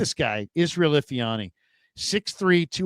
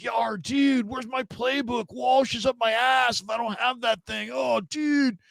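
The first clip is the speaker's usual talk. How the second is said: much higher, airy surfer-dude drawl; young slacker dude; 10 semitones higher than usual. Retold or imitated. Rookie QB fretting about his playbook, high boyish dude voice. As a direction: sound like a teen who rode in on a skateboard. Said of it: "he sounded so young".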